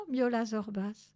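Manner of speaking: plain reading voice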